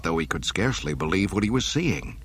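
plain reading voice

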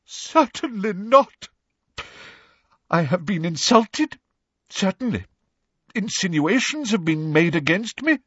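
high, theatrical wounded indignation; overwrought, halting hurt pride; throbbing with emotion. A narrator's reading. Professor Trelawney protesting she was insulted, throbbing with emotion. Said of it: "in a voice throbbing with emotion".

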